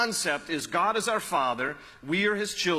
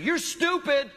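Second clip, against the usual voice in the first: put-on high, bratty child's yell; whiny kid screaming at his mom in the aisle; 11 semitones higher, 4 dB louder, slower than usual. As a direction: mimic a shrieking child insulting his mom. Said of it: "he kept saying".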